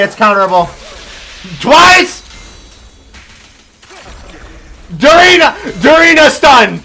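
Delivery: loud, high, panicked gamer shouts; yelling in disbelief mid-fight; excited yelling. Real, not acted. Boss fight, frantic reactions.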